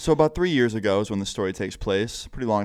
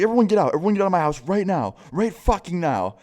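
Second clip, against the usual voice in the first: higher, louder retold mom yell kicking them out; imitating angry yell; 8 semitones higher, 4 dB louder than usual. Mom throwing the teens out of her house, mock furious yell. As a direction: play the furious mom ordering everyone out. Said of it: "she starts freaking out".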